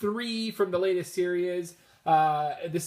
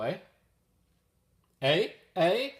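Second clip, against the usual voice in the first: Canadian accent. Real, not acted. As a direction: let your Canadian accent slip on "eh?". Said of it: "little Canadian accent there just slipped out".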